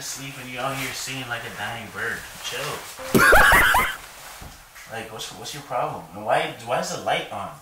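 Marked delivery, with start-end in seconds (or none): 3.3-4.9 loud burst of laughter. Real, not acted. Laughing at the singing.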